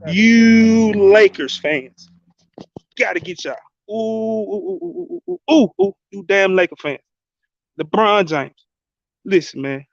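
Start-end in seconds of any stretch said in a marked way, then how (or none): 0.1-2.1 slow, stretched and higher callout to the fans; slow taunting call to rival fans; drawn-out callout. Real, not acted. Calling out Lakers fans. 3.9-5.9 high mocking ooh hoots; high taunting ooh-ooh hooting. Real, not acted. Taunting Lakers fans.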